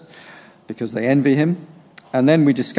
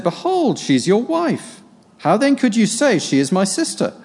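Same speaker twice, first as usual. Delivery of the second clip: indignant king; 9 semitones higher than usual. Acted. Abimelech confronts Isaac.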